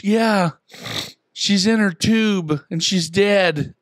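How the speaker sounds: put-on high, slow child's insistence; imitating daughter. Father imitates daughter insisting the pet is dead, high and slow. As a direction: drag out the kid's fake grief. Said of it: "And she goes".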